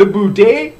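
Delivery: bad Canadian accent. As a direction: put on a poor Canadian accent. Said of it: "don't have a good Canadian accent".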